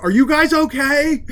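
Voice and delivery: high-pitched shout, as if deafened; oblivious over-loud shouting; unnecessarily loud. Acted. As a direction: yell it like your ears are ringing. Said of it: "speaking un-, unnecessarily loudly".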